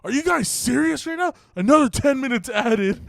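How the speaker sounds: pissed-off leader. Retold or imitated. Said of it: "He's just like".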